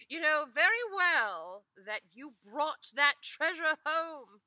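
high, loud, slow insistence; indignant wife. Acted. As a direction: press the point hard.

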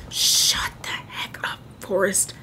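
slow, drawn-out whisper; hushed whisper. Real, not acted. Player hushing Forrest as killer lurks, slow whisper. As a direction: whisper it slowly to silence him.

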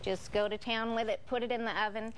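ordinary delivery

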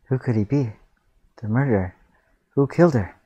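usual speaking voice

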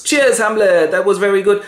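high, loud royal toast; cheery queen. Acted. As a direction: toast a son, voice lifted. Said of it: "the queen takes a drink".

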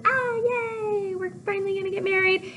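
high, squealing mock delight; excited. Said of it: "they get all excited".

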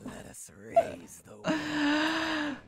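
slow, loud whispered cry; slow whispered demonic cry. Acted. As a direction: breathe it out like a demonic vow.

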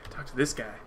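usual speaking voice

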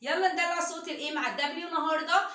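normal talking voice

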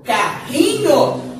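Carrinho said slowly with a guttural rr like h; strong double R. Shown as a demonstration. Teacher showing children the strong RR, slowly. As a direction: push the RR in Carrinho out from the throat. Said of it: "olha como vai ficar diferente".

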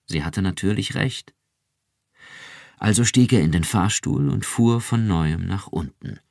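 usual speaking voice

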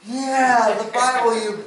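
high, loud, exasperated imitation; mock frustrated outburst. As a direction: act out a man fed up with being misunderstood. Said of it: "he's getting frustrated at me".

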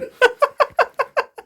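loud, high laughing burst; real high-pitched chuckling fit. Real, not acted. Laughing while cooking together.

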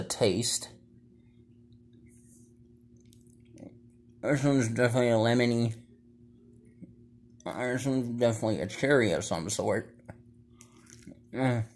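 candy ropes in mouth; talking while chewing. Real, not acted. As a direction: mouth full of chewy sweets. Seen 0: Gummy ropes hang from his mouth while he talks and chews.

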